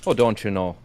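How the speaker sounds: loud Minnesota accent; hammed-up Minnesota accent. Shown as a demonstration. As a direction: stretch the vowels, Upper Midwest style. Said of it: "that's more Canadian".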